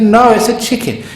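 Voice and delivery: mock bickering spouse digging in; insisting in a quarrel. Acted quarrel of the couple.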